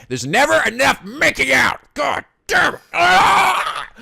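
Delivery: high, loud rising rant ending in a yell; frustrated outburst. Real, not acted. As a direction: blow up in comic outrage. Mock outrage at the game.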